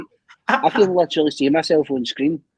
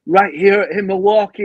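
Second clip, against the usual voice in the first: slow, higher hammed-up rally speech; a hint of a politician working the crowd; mock politician; 6 semitones higher, 4 dB louder, slower than usual. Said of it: "they'd go like that".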